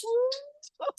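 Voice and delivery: high falsetto whoop; high cheering woo. Real, not acted. Celebrating the second place.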